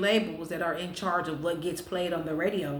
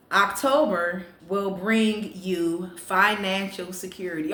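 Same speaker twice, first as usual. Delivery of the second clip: fortune reading; slower than usual. Acted.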